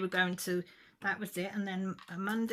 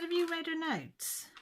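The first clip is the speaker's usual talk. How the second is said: much higher and slow, demanding an answer; retold furious challenge; 12 semitones higher, 4 dB louder than usual. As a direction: press her hard, slowly, with the question. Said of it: "Well, I lost it".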